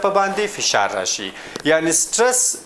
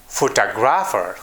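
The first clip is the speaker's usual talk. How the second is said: "Photographer" stressed on TOG; "Photographer" as pho-TOG-ra-pher, a careful word demo; stress on middle syllable; 6 semitones lower than usual. Shown as a demonstration. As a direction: lean on the second syllable of "Photographer". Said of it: "almost always in the middle".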